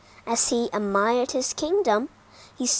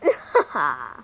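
low, booming monster laugh; deep mocking dragon chuckle; 8 semitones lower, 3 dB louder than usual. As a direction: laugh like a huge beast. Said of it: "The dragon laughed".

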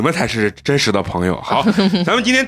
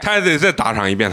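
ordinary talk, nothing marked

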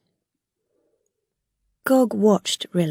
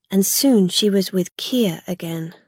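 ordinary delivery, nothing marked

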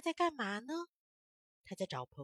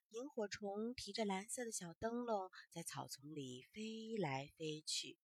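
soft storytelling; 2 semitones lower, 8 dB quieter than usual. A narrator's reading. Gentle storytelling to children.

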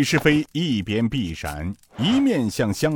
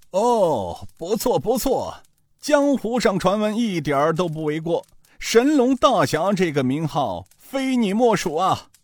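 put-on higher, slowing old man's praise; admiring old thief; 8 semitones higher than usual. Old thief sizes up the young hero, praising. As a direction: savour each compliment.

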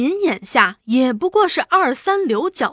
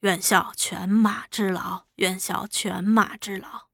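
low, soft and humble pledge; respectful, deferential; 4 semitones lower, 7 dB quieter than usual. A narrator's reading. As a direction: offer service to a superior, softly and respectfully. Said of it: "许卫民恭恭敬敬地说". (恭恭敬敬，低声表态)

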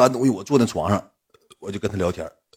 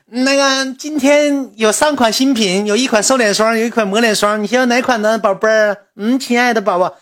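sugary saleswoman; 12 semitones higher, 4 dB louder than usual. Retold or imitated. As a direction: coax the customer sweetly, like a live-stream seller. Assistant turns sweet saleswoman.

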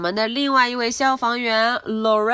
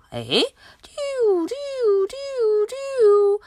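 much higher wailing siren call; siren imitation; slower than usual. Reader imitating the siren for the children. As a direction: wail like a fire siren.

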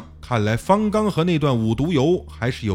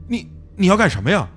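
fast, stammering panic with a raised voice; frightened stammer; 6 semitones higher, faster than usual. Acted. Narrator recoiling from Fang Gang's knife, panicked. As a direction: blurt it out as the knife comes at you. Knife-wielding man lunges at him.

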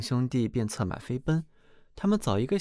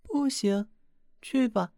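much higher, curt dismissive princess; 12 semitones higher, slower than usual. Acted. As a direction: brush the suitor off from above. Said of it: "国王的女儿说道". (公主高声冷淡地打发人)

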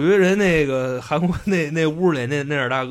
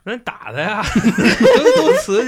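laughing; 7 semitones higher, 5 dB louder than usual. Real, not acted. Laughing joke to hit guide.

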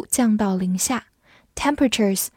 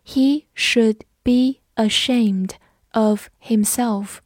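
slow, carefully separated words; slow read-along; slower than usual. Shown as a demonstration. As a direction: read it slowly for learners to follow. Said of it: "我们慢读一遍". (慢速逐词领读)

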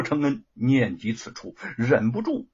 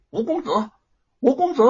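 calling out louder from the doorway; 门外呼喊; 10 semitones higher, 5 dB louder, faster than usual. Acted. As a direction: shout his name to fetch him out. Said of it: "在门口喊道". (陌生男人在门口高声喊吴公子)

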